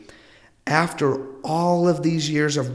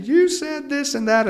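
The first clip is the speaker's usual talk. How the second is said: a higher mock accusing voice; a touch of a mock complainer, higher; complaining listener; 12 semitones higher than usual. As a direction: lightly play someone blaming the host. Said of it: "somebody coming in saying".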